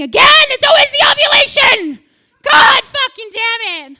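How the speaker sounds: high, loud frustrated yell; mock rage shout. Comic cursing her ovulation, loud high frustrated yell. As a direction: rage at your own hormones.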